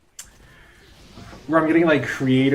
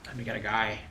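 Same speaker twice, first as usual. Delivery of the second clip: ordinary delivery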